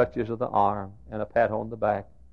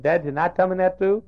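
put-on higher little-girl voice; toddler's plea; 9 semitones higher, 7 dB louder than usual. Youngest daughter asking father, higher child voice. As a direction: plead like a two-year-old. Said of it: "and she said".

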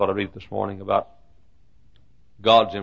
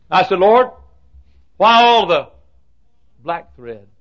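loud, high plea to heaven; anguished cry; 8 semitones higher, 10 dB louder than usual. Acted. Preacher acting out his questioning of the Lord, loud anguished cry. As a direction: cry out in protest to God. Acted cry to God in sermon.

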